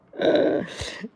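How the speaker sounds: high embarrassed giggle. Real, not acted. Embarrassed by a compliment.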